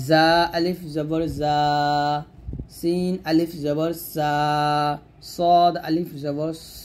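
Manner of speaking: slow drill, জা, সা, স held long; tongue-to-teeth madd. Shown as a demonstration. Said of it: "জিহ্বাটা নিচে দাঁতের মাঝখানে লাগিয়ে".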